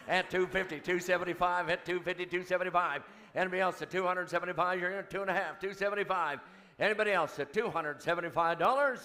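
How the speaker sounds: rapid auctioneer chant. Real, not acted. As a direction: rattle off the bids like an auctioneer.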